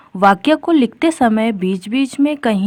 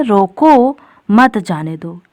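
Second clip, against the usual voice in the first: slow, drawn-out रोको, a break, then मत जाने दो together; pause after रोको; slower than usual. Shown as a demonstration. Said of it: "यानी जो व्यक्ति है उसे रोक लो".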